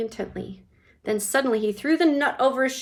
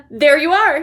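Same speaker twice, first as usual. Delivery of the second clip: higher, louder triumphant cry; 6 semitones higher, 13 dB louder than usual. A narrator's reading. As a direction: Wonka proving his candies look round, triumphant. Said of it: "he said triumphantly".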